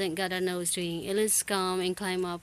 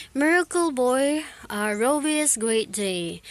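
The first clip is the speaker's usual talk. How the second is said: headline read; 4 semitones higher, 4 dB louder than usual. Acted. Reading a headline.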